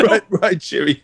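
chirpy cartoon British cheerio; British accent. Copying a friend's British impression, chirpy mock British. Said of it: "British accent".

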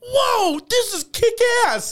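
high, slow mock kid excitement; drawn-out, high-pitched gleeful exclamation; thrilled Anakin. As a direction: whoop it like an over-excited young pilot. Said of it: "Anakin Skywalker in a fucking".

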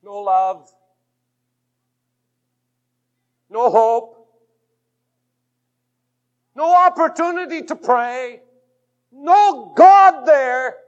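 from plain to loud, high, very slow; preacher rising to a fearful peak; building preaching climax. Acted.

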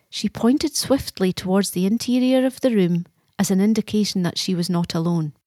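usual speaking voice

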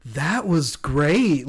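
very slow, drawn-out mock praise; co-hosts' praise imitated. Quoting co-hosts reacting to the impression, slow mock praise. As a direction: stretch the words out as imitated praise. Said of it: "her co-hosts were like".

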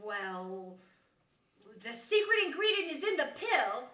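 normal talking voice

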